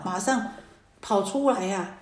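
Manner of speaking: ordinary talk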